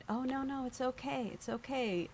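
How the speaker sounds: imitated comforting officer; imitating reassurance. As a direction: gently hush someone who is upset. Police comfort the crying victim.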